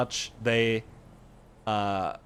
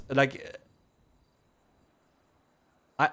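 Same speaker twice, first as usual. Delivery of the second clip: normal talking voice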